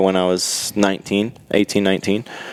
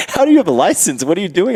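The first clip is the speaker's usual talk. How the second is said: high mock disbelief, pitched way up; incredulous clerk; 11 semitones higher, faster than usual. DMV clerk baffled by a foreign driver, high incredulous voice. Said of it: "And they're like".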